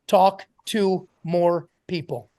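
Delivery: said slowly, every word spaced out for note-taking; slow dictation. Shown as a demonstration. Coach to Sharon, dictating a rule slowly. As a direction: spell it out slow so they can write it. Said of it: "write this down for yourself".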